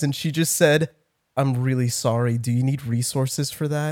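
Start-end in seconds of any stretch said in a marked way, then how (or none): 1.4-3.9 soft, gently concerned nurse; lightly voiced caring nurse, quietly sympathetic; gentle concern. A nurse gently offering help, soft and concerned. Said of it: "she had really sad eyes".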